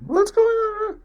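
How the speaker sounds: high-pitched imitation of an excited mom; mock excited mother, voice way up high. Mom asking dad at the front door, mock excited. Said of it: "my mom's all excited".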